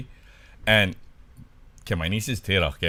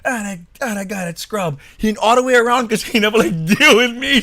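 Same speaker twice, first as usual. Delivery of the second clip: high, worked-up mock rant, getting louder; losing it; 8 semitones higher, 4 dB louder than usual. Niece rants at the parked car, losing it. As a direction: build an imitated tirade, breaking into laughter. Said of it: "just losing it".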